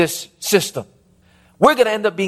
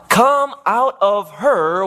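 very slow, stretched heavenly summons; much slower than usual. Acted. Preacher reads heaven's call to the congregation, slow summoning voice. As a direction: draw out each word like a call from heaven. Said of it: "I heard another voice from heaven saying".

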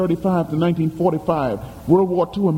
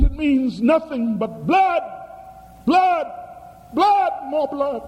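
blood, blood, more blood building in pitch; ominous rising cry; 9 semitones higher than usual. Retold or imitated. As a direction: pitch climbs on each blood, higher and higher. Prophetic woman, rising cry of blood.

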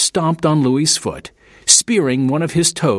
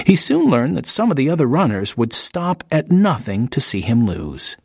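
plain reading voice

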